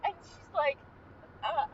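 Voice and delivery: ordinary delivery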